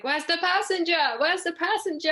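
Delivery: high, loud urgent call for the passenger; captain calling out. Retold or imitated. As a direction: call out like a fussy little captain. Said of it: "He's like".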